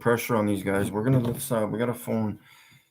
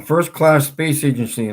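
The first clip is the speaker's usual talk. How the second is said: mocking; 5 semitones higher, 6 dB louder than usual. Shown as a demonstration. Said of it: "Let's phone these schmuckaroos first".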